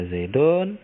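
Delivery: "Zaidun" ending in -un, pitch rising; rafa ending -un. Shown as a demonstration. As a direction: say "Zaidun" with a u before n. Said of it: "ketika dia rafa dibaca". (baca "Zaidun" dengan akhiran -un)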